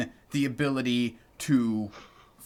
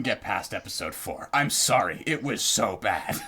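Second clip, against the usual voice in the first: low, manly Australian-ish voice; deep manly voice; 6 semitones lower than usual. Retold or imitated. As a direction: drop into a gruff manly Aussie voice. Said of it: "make my voice sound so deep and manly".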